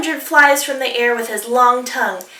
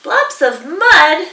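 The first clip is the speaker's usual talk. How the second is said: slow, louder incredulous question; surprised dingo; 6 semitones higher, 8 dB louder, slower than usual. Acted. Dingo delighted by platypus's mud idea, slow and surprised.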